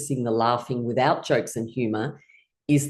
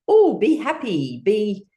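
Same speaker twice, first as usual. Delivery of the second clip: higher, stretched-out mock delight; acted happy signal; 6 semitones higher, 4 dB louder, slower than usual. Teacher voicing what victory pose tells the body, happy. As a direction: sing out mild joy, slowly. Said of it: "it signals to your body".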